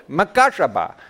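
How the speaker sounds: makashaba in an exaggerated Indian accent. Shown as a demonstration. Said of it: "say it with an Indian accent".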